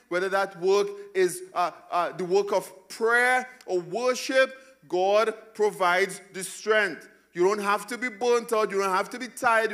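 climbing, loud pulpit delivery; full-force preaching. Acted. Pastor rousing the congregation, preaching at full force.

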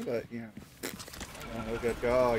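ordinary talk, nothing marked

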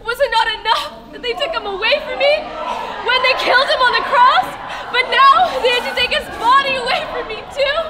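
high-pitched panicked searching, building to loud anguish; frantic mourner demanding answers; distraught. Acted. Acted grief at the empty tomb.

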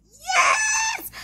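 squealed yes; excited high shout. Real, not acted. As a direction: shriek it out with joy.

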